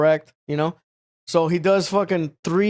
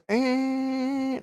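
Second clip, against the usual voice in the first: imitated grunt; 6 semitones higher, 5 dB quieter than usual. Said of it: "he goes ... like that".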